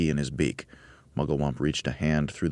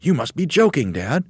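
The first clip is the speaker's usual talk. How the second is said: higher, louder disbelief; astonished cry; 10 semitones higher, 4 dB louder than usual. Acted. Little monkeys crying out to their dad, astonished.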